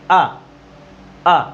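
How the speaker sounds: "आ" said in one short jerk, cut off at once; one sharp burst. Shown as a demonstration. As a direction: snap out "आ, आ" in a single quick burst and stop. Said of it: "एक ही बहुत ही झटके में बोलना". ("आ" एक ही झटके में, आवाज़ आई और ख़त्म)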